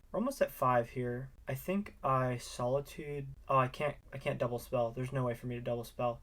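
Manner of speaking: ordinary talk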